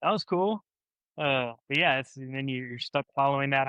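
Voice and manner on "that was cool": amazed. Retold or imitated. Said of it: "I was like".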